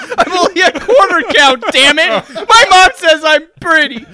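mock wounded whine. Derek fake-hurt after cow joke.

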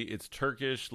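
ordinary delivery

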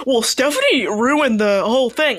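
slow, drawn-out mock complaint; mocking quote. Arguing player parrots a rival's complaint, mocking. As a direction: throw their words back, dragged out.

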